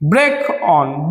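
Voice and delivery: slow, low and separated; syllable-by-syllable scansion. Shown as a demonstration. Teacher dividing verse into syllables, slowly and low. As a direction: draw out each syllable to be counted. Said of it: "দুটো syllable একটা slash".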